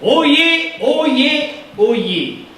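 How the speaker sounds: town crier. Acted. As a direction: cry out like a herald. Proclamation call.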